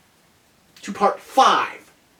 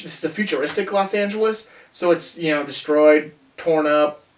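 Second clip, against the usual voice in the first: usual speaking voice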